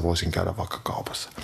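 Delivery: quick, soft whispered line; fast whisper. Acted. Host whispering the example line to husband. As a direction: rush it out in a whisper. Deadpan advice-show host aside.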